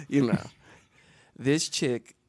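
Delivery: ordinary delivery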